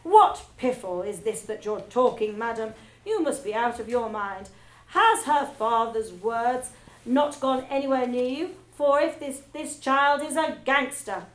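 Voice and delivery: loud, scornful rage; furious tirade. Acted. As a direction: tear into her. Trunchbull rages at Miss Honey.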